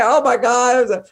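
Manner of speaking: put-on high, slow, loud child's lament; upset child. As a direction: drag it out like a heartbroken kid. Child crying over ice cream.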